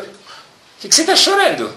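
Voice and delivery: puzzled question. Retold or imitated. Man asks crying woman.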